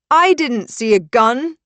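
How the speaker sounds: high, loud, anguished; tortured cry. Acted. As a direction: cry it out in torment. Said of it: "Brecken cries, sounding tortured".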